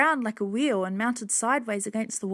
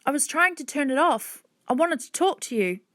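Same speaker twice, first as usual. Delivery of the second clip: put-on apologetic child; 5 semitones higher than usual. A narrator's reading. Hannah explains to angry man.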